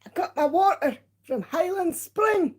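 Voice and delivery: high-pitched, exaggerated mock Scottish; hammed-up Scottish attempt; attempted Scottish accent. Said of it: "That was an attempt at a Scottish accent".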